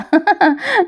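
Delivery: a short amused laugh; quick amused laugh after a joke; light laugh. Real, not acted. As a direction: chuckle at the show. Narrator laughs at serial dragging.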